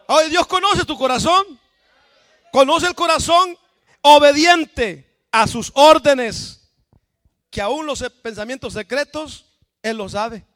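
high, loud preaching cry; full-force preaching. Acted. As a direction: preach it out with fire. Predicación a pleno pulmón. (predicación a todo pulmón, aguda y fuerte)

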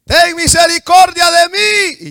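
high, very loud drawn-out cry; full-lung shout. Acted. Blind man cries out to Jesus over the crowd, full-lung shout. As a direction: fill your lungs and holler for mercy. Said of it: "mejor agarró aire con sus pulmones".

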